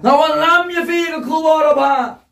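loud, high repetition of scripture; full-force preaching. Real, not acted. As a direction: belt the verse to the back pews.

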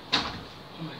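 ordinary delivery

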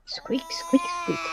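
breathy high squeaky-toy imitation; whisper-squeak like the dog's toy. Copying the dog's squeaker for viewers, whispered squeaks.

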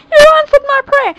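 high, loud joyful cry. A narrator's reading. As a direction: cry out to her with joy. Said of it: "Charnel cried".

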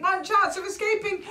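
high, tense exclamation; breathless-with-suspense storytelling; urgent. A narrator's reading. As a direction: raise the pitch for sudden peril. Mole's urgent moment of escape.

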